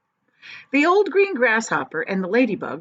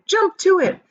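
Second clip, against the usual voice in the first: much higher and louder urgent command; shouting; 10 semitones higher, 5 dB louder than usual. A narrator's reading. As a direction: bark the orders like a captain. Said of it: "James shouted".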